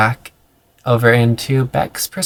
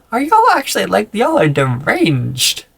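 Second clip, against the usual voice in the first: incredulous squeal; 8 semitones higher than usual. Real, not acted. Reacting to the book's lines.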